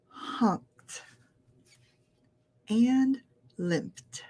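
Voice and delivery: one word at a time for learners to repeat. Shown as a demonstration. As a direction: softly model each word, long pauses between. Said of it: "a little slower".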